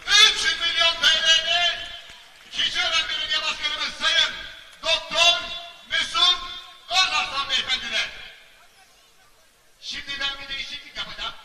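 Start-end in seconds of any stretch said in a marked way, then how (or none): 0.1-8.1 auctioneer. Acted. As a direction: call the bid out to the hall. Auctioneer calls out bid loudly. (açık artırmacı gibi yüksek sesle)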